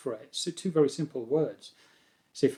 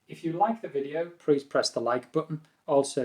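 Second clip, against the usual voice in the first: normal talking voice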